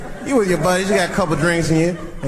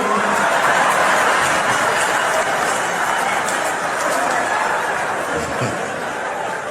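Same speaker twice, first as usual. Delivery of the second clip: a long airy laugh at his own joke; long breathy laugh; 7 semitones higher than usual. Real, not acted. Comic laughing at his own fake threat to a heckler, breathy laugh. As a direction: let a breathy laugh roll out.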